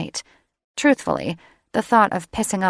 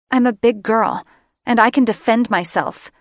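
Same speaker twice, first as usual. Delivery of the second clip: louder, sharper retort; harsh; 4 semitones higher, 5 dB louder than usual. Acted. Sarah snapping at Drake, harsh retort. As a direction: bite it out harder than meant. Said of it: "said more harshly than she meant".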